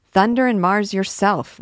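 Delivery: student cheekily echoing the captain's loud oath; mock swearing back. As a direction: fling Thunder and Mars yourself back in jest.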